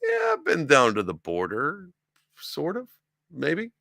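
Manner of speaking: high, loud, drawn-out evasive answer; lightly hammed-up dodging politician; mock Kamala Harris. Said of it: "She's like".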